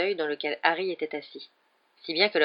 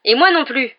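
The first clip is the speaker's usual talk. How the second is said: higher and much louder, indignant; angry Harry; 9 semitones higher, 11 dB louder than usual. A narrator's reading. Harry angrily defends his father against Snape's insults.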